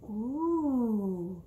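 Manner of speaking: ordinary delivery, nothing marked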